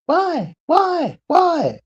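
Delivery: put-on very high child's voice, why over and over; pestering child. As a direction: nag like a curious toddler. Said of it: "Children ask it".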